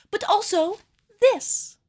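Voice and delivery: cringey overexcited host voice; over-the-top reveal. Acted. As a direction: hype up the big reveal. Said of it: "Wow, I hated that".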